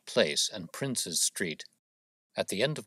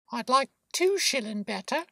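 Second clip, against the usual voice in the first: put-on high, eager small-child voice; little boy; 4 dB louder than usual. A narrator's reading. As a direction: pipe up boldly as a little lad. Same boy raises his request.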